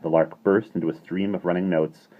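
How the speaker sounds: usual speaking voice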